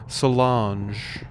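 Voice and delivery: "Solange" with an ah, so-LAHNZH; corrected pronunciation. Shown as a demonstration. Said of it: "it's Solange, not Solange".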